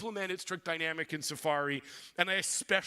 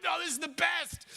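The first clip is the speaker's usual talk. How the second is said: gleeful; 8 semitones higher than usual. Retold or imitated. Gleeful reply to Google.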